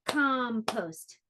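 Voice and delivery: "Compost" in two distinct beats; stretched, separated syllables in "Compost"; clapped-out syllables. Shown as a demonstration. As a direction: clap out "Compost" as com, post. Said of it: "How many claps?".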